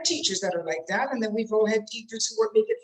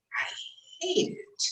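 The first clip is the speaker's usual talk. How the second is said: demonstrated contempt, much softer; acted loathing; 11 semitones higher, 7 dB quieter than usual. Shown as a demonstration. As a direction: spit disgust softly on "hate". Demonstrates hate after love.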